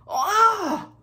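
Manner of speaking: high, loud "oh" of dismay; anguished cry. Real, not acted. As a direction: cry out in mock agony. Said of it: "outcry of embarrassment".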